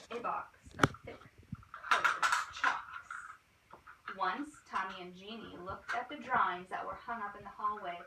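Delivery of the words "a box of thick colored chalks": slow, breathy, unvoiced savouring; slow whisper. A narrator's reading. Whispering to the children about the art teacher's chalks. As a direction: linger on each word like a treasure.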